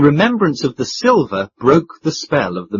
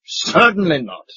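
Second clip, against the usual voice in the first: a curt, offended denial; indignant; 4 semitones higher than usual. A narrator's reading. Mister Audley to the proprietor, indignant. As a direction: dismiss it haughtily. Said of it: "answered Mister Audley indignantly".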